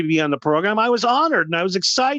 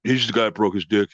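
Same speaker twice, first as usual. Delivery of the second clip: much lower, sneering voice of the crowd; mocking podcast crowd; 10 semitones lower than usual. Crowd on every episode repeating the joke, low mocking voice. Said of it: "six thousand fucking episodes".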